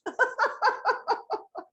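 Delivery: a high giggle at her own joke; amused real giggle; high laugh. Real, not acted. Laughs after lettuce joke.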